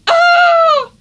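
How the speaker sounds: very high, loud shriek. A narrator's reading. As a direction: scream in fright. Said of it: "shrieked Peter".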